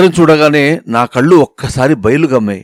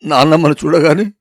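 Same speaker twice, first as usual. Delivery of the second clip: usual speaking voice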